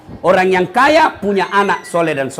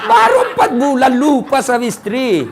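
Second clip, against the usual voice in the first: amused, laughing out the words; laughing punchline; 6 semitones higher than usual. Real, not acted. Preacher laughing at the quickly remarried widower. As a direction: laugh at the hypocrisy.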